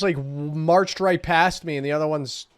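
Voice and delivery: usual speaking voice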